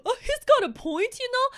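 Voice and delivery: hammed-up mimic of movie Ron; mocking movie Ron. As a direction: sneer it like a spineless sidekick. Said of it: "That is so freaking annoying".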